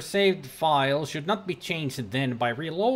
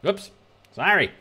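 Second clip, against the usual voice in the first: normal talking voice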